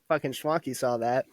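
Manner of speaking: usual speaking voice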